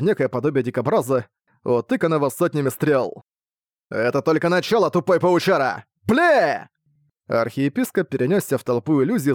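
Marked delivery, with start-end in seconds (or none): 3.9-6.7 angry monster boss. Acted.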